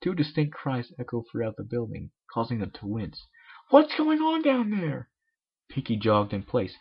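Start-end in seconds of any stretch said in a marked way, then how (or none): 3.7-5.1 high, slow call from upstairs; a high voice calling down the stairs. Acted. The Cakes call downstairs in a high voice.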